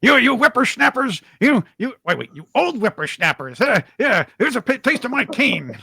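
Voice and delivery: put-on high, loud cranky old-timer yelling; grumpy old man. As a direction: shake your cane at the kids.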